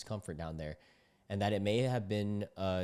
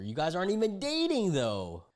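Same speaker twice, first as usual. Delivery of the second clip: incredulous; 10 semitones higher, 5 dB louder than usual. Real, not acted. Reacting to her provision claim.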